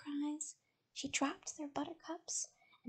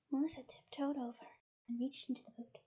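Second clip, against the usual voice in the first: ordinary delivery, nothing marked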